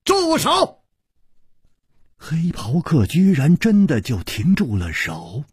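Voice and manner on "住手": high, sudden shouted command; loud shout. A narrator's reading. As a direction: cry out sharply to stop someone. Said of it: "大喝道". (高声大喝)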